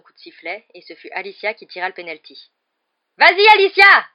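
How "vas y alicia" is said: very high, full-force cheer; yelling. A narrator's reading. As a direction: yell her on across a silent stadium. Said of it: "hurla Lee dans le silence". (hurlement d'encouragement)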